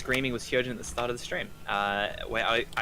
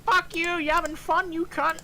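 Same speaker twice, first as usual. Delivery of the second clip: very high, cartoonish bot voice; absurdly high mock insult; Nightbot; 14 semitones higher, slower than usual. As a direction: squeal it like a rude little bot. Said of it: "Nightbot was just like".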